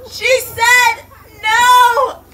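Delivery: slow, high, loud cry of joy; thrilled shout. Real, not acted. Teacher cheering a student's answer, slow and loud. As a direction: celebrate the word no.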